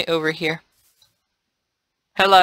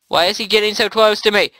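usual speaking voice

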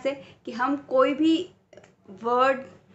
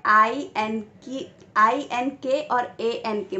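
slow spelling out; slower than usual. Shown as a demonstration. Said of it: "जैसे आप ये देख सकते हो".

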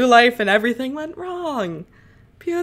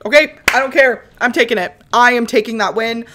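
defiant triumph; 3 semitones higher, 9 dB louder than usual. Real, not acted. Accepting the result.

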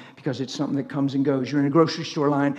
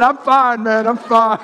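quoting the cashier through laughter, higher and louder; laughing quote; 7 semitones higher, 9 dB louder, slower than usual. Retold or imitated. Mimicking the cashier between laughs. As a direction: let the laugh break into the words. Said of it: "He said".

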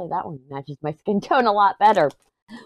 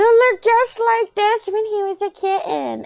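much higher, fond gushing voice; gushing coo; 14 semitones higher than usual. Real, not acted. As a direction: gush about the kitten. Gushing about her kitten.

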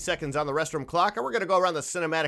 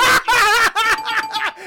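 loud laugh; 9 dB louder than usual. Real, not acted. Big laugh at joke.

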